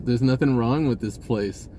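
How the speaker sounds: normal talking voice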